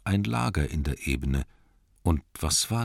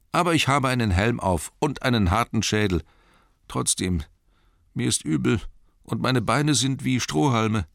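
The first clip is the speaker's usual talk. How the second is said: higher voice, a little dazed; hurt hobbit; 7 semitones higher than usual. Acted. Injured Bilbo telling his rescuer how he is, high and dazed.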